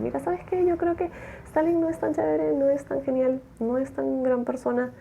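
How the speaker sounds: high, pushy mock voice of the objector; squeaky defiant heckler voice; challenging churchgoer. Objector in church contradicting Jones, high mock voice. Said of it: "un tipo le refutó".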